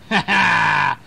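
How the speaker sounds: loud, high gloating ha ha; villain's laugh. Acted. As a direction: laugh like a bully with a plan. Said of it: "And Brom said".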